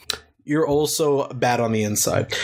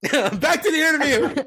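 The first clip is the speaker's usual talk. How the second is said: laughing; 12 semitones higher than usual. Real, not acted. Laughing while resuming.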